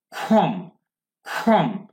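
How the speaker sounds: খম with a throaty, aspirated kh at the start; glottal kh. Shown as a demonstration. Teacher drilling a glottal sound for learners, throaty kh. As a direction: say খম from the throat, each one separate. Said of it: "glottal থেকে".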